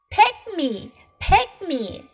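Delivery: high, eager plea of a cat; a little cat begging to be picked; eager cry. Acted. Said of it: "cried Cat".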